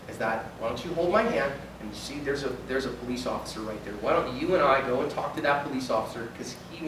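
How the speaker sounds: put-on calm the scared kid down; reassuring.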